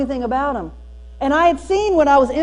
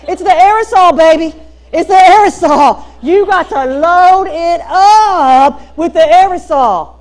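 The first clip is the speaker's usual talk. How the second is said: excited revelation; 4 semitones higher, 8 dB louder than usual. Real, not acted. Gushing about the hair secret.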